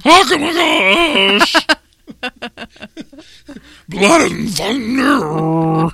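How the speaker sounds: slow, high, loud Sloth voice; super slow sleepy sloth. Pretend. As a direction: drag it out like Goonies Sloth. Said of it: "In Sloth's voice".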